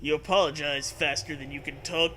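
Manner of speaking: ordinary talk, nothing marked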